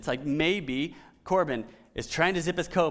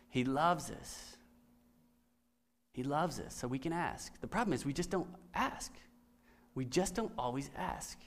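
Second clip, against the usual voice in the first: soft tender; 4 semitones lower, 10 dB quieter than usual. Real, not acted. Tender point of the sermon.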